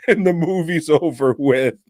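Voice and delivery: laughing through the words; can barely finish for laughing. Real, not acted. Telling friends the movie's ending, laughing hard. Cracking up at own joke.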